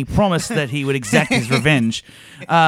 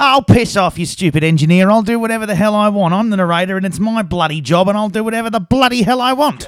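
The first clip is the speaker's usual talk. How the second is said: loud, high-pitched defiant rant; angry tirade; 7 semitones higher, 6 dB louder than usual. Acted. Narrator defying the engineer who told him not to swear, angry. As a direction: tell him off at full voice.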